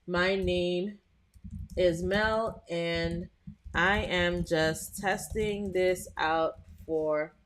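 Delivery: slow, drawn-out test sentence; lightly dictated test line; dictating while typing. Shown as a demonstration. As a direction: spell it out slowly as you type. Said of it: "what I'm gonna say is I'm gonna do a test".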